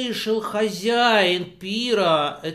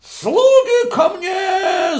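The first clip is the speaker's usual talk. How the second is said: much higher, slow, loud shout; the host summoning servants, loud high shout; 12 semitones higher, 6 dB louder, much slower than usual. Acted. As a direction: call the servants with a roar. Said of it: "закричал хозяин".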